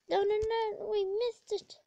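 high-pitched string of no's; dismayed protest. Real, not acted. Gamer who missed the target, high dismayed cry. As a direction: wail it in sudden dismay.

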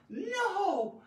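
high, drawn-out dramatic protest; overblown, high-pitched outcry; over-the-top dramatic. Shown as a demonstration. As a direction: play it big and melodramatic. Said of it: "since this is so dramatic, over the top".

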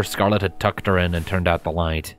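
usual speaking voice

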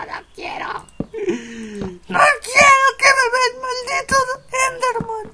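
very high, loud mock wailing; hammed-up crying screams. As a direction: bawl in exaggerated panic at being seen. Los gritos que están en esta casa. (llanto fingido a gritos, muy agudo)

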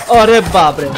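sudden loud exclamation of alarm; startled shout. Real, not acted. Gamer caught off guard in game, startled shout.

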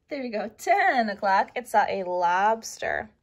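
plain reading voice

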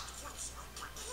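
soft, airy gasp; quiet real gasp; amazed gasp. Real, not acted. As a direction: suck in a breath of amazement. Amazed at the trailer.

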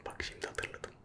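usual speaking voice

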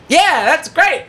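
high, thrilled yelp; mock over-eager fan. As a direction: squeal yes like a starstruck fan. Plant acts thrilled to join.

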